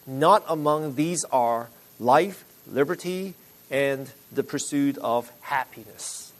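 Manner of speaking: slow, deliberate reading; reading aloud slowly. Shown as a demonstration. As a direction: read it out slowly, word by word. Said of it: "I'll read it slowly so you can catch it".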